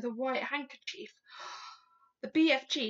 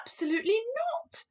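raised, emphatic no; firm refusal; 11 semitones higher, 5 dB louder than usual. A narrator's reading. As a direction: refuse firmly, pitched high and loud. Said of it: "told him firmly".

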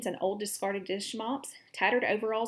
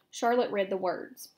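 plain reading voice

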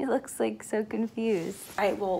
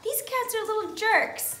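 annoyed fan; 10 semitones higher than usual. Retold or imitated. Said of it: "you'd probably be like".

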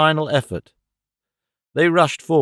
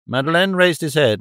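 ordinary delivery

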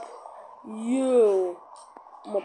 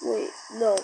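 ordinary delivery

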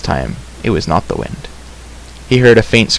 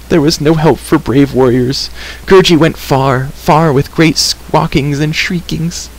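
higher, slow creature's lament; moaning creature; 6 semitones higher, slower than usual. A narrator's reading. Creature recounting his search for help, moaning. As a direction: wail pitifully in a put-on voice. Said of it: "Gurgi moaned".

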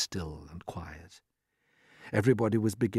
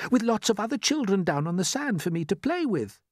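put-on high, hopeful boy's voice; eager; 10 semitones higher than usual. A narrator's reading. James wishing for playmates, high and eager. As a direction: pipe it out as a wish. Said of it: "said James eagerly".

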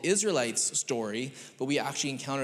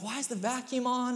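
sleepy wife; 10 semitones higher than usual. Retold or imitated. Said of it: "She's like".

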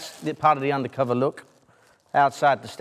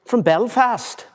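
high, drawn-out Belfast impression; attempted Belfast accent; 10 semitones higher, slower than usual. Recruit asked for other accents, high slow mock Belfast. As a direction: stretch out a put-on Belfast lilt. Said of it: "what other accents can you do?".

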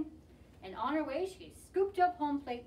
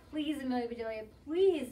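shouted plea; 6 dB louder than usual. A narrator's reading. Team begging the batter, plea rising to a shout. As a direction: beg louder, peaking on the last word. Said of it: "shouted the Grizzlies".

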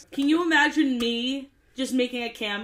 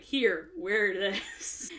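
slow, bored hand-off; offhand casting director; 2 semitones lower, much slower than usual. Retold or imitated. Casting director handing over the shirt, slow and bored. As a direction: toss him the shirt without caring. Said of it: "the casting director's like".

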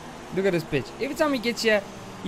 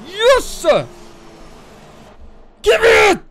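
higher, louder triumphant yell; excited shout; 10 semitones higher, 9 dB louder, slower than usual. Real, not acted. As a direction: cheer the restart on. Race restarts.

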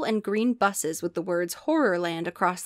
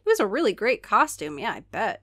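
plain reading voice

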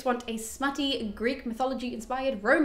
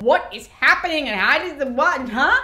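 confused outburst; 4 semitones higher, 7 dB louder than usual. Retold or imitated. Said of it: "and I was like".

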